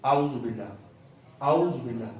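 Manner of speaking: اعوذ run together as "auz" before باللہ; common mistake. Shown as a demonstration. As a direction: merge الف and عین in اعوذ into one short syllable. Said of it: "اعوذ باللہ نہیں ہے". (اعوذ باللہ، "اوز" کی طرح ملا کر)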